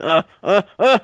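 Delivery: loud, slow, drawn-out climax grunt; hammed-up Roosevelt grunt. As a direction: grunt then bark the catchphrase. Roosevelt finishing sex with catchphrase.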